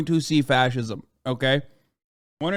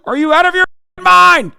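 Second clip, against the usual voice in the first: much higher and louder, yelling in disbelief; furious outburst; 10 dB louder than usual. Real, not acted. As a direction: blow up at the commenter. Ranting back at a chat commenter.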